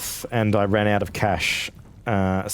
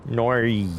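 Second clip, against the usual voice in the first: broad Aussie "Nori" for no; exaggerated Australian accent. Shown as a demonstration. Streamer demonstrating a no, exaggerated Australian accent. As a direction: turn "no" into a two-part Australian "Nori". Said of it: "You gotta just go with a nori".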